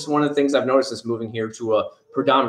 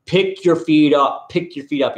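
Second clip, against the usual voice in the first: louder, slow call to pick your feet up; drawn-out mock scold; mock nagging call; 5 semitones higher, 4 dB louder, slower than usual. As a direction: nag them like a parent. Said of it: "I'm like".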